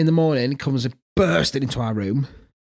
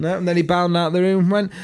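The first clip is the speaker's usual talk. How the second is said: ordinary talk, nothing marked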